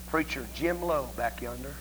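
ordinary talk

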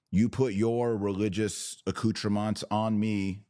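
ordinary talk, nothing marked

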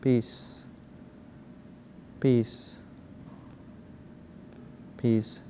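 hushed, fading word repeated slowly; soft repeated mantra. Shown as a demonstration. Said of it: "In your mind".

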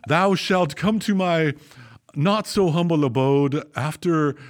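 olde English, lofty and slow; hammy stage actor. Retold or imitated. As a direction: proclaim like a Shakespearean lord. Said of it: "And he replied".

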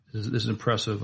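normal talking voice

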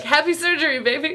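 high, loud laughing; laughing affectionate. Retold or imitated. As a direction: say it through laughter, fondly. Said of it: "And he was like".

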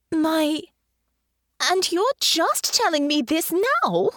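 high, slow, incredulous question; shocked. Acted. Honor learns her mother owns the airship, shocked. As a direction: react in high-pitched disbelief.

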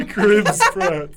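repeating the name through a laugh. Real, not acted. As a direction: say it again, cracking up. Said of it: "this is, this is hilarious".

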